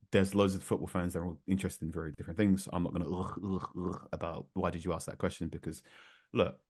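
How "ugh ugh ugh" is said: mock grumbling. Host declining to grumble about others' questions, mock grumbling. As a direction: imitate grumbling, half-hearted and soft.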